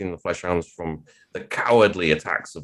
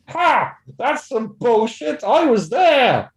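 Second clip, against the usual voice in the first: high yelled grumpy granddad; from another room; 5 dB louder, slower than usual. Retold or imitated. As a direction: shout it from the next room like an old vet. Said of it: "from the other room".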